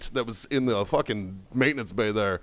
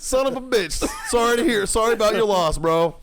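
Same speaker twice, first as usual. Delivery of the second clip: high, louder hammed-up condolences; mock sympathy; 8 semitones higher, 3 dB louder than usual. Sergeant faking sympathy for the dead sensei. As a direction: overplay the sorrow sarcastically.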